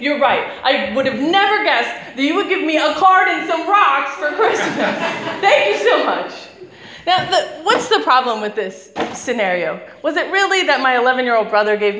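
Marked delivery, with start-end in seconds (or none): 0.0-6.3 louder, mock-grateful with laughter; laughing sarcastic thanks. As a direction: thank him dryly while laughing. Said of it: "I'm thinking".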